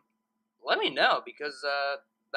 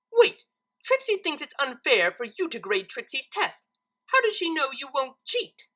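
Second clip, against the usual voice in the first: higher, suspicious showoff unicorn; higher voiced boastful Trixie; 9 semitones higher, 4 dB louder than usual. A narrator's reading. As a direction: Trixie demanding a fair grader.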